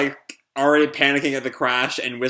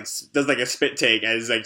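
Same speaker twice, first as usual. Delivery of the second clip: ordinary delivery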